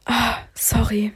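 langsames, nachgeahmtes Flüstern; verschämte Entschuldigung. Retold or imitated. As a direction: sich gedehnt und tonlos entschuldigen. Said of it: "das nicht laut aussprechen". (verlegen gehauchte Entschuldigung)

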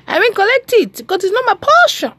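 high, loud defiant claim; defiant declaration. Acted. Preacher rejecting depression, high and defiant. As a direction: declare it like a victory shout. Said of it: "declaring over rubbish".

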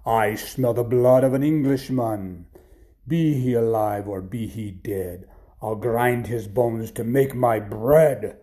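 big, slow, booming chant; menacing giant. Acted. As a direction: draw out each word like a threatening giant. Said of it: "this giant says".

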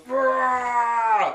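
ogre roaring out loud; monster roar. Acted. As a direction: bellow it like a big ogre. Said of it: "He goes".

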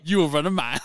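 hammed-up movie coach; coach impression. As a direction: order it like a tough football coach. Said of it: "He says John Brown".

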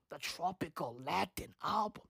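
unvoiced, hushed aside; whisper. Real, not acted. Mocking the Grammy category to viewers, in a hushed whisper. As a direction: whisper it like a secret.

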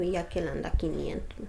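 whispered, low and hushed; officer whispering how much the other carries; retold whisper. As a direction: drop to a secret whisper. Officer whispers the partner's amount.

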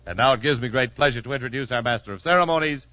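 ordinary talk, nothing marked

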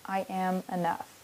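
hushed little mantra; calm affirmation. Shown as a demonstration. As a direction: say it gently, as if to yourself. Example affirmation for meditation.

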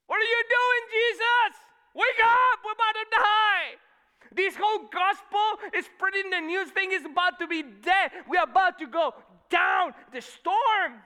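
high, very loud panicked screaming; frantic disciples yelling over a storm; freaking out. Acted. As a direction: scream to wake him before you drown. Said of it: "they're all freaking out".

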